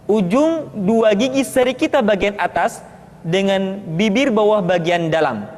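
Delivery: ordinary talk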